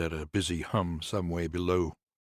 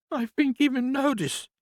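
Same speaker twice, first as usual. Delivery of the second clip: much higher, louder woman's voice, bitter; grieving nurse; 6 dB louder than usual. Acted. Nurse complains to Conrad about the house, higher voice. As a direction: lift into the nurse's aggrieved voice.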